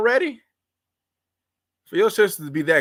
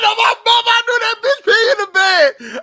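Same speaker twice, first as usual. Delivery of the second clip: very high, loud shriek of disgust; 8 dB louder than usual. Real, not acted. Host reacting to dirty-house story, loud high disgusted shriek.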